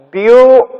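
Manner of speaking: "Beautiful" split into three separate, held syllables. Shown as a demonstration. Teacher syllabifies the word for children, "Beautiful" in separate syllables. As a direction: pause after each syllable of "Beautiful". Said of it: "Just syllabify the word".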